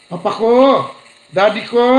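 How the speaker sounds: high, sweet "Papa ko!"; sweet calling. Shown as a demonstration. Preacher showing how a loving child calls a father, sweetly. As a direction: call "Papa ko" lovingly, claiming him. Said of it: "Mas sweet yung sabihin".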